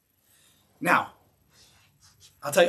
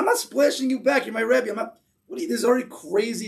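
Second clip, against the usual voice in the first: higher, louder agitated refusal; student refusing to splash back his rebbe, flustered; flustered protest; 7 semitones higher, 3 dB louder than usual. Retold or imitated. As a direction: protest as if this is madness. Guy protesting splash request.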